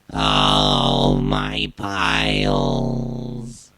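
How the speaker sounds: deep warbling effect voice; Max Headroom hijacker voice. Shown as a demonstration. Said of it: "recreate the Max Headroom hijacker voice".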